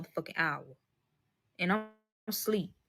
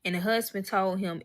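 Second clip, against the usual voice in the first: ordinary delivery, nothing marked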